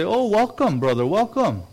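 high, loud, gushing welcome; naive sheep welcome. Acted. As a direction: greet the wolf like an overjoyed, trusting friend. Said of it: "all the sheep are gonna say".

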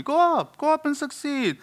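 put-on high, sarcastic Yiddish accent; mock the prophets in a Yiddish accent.

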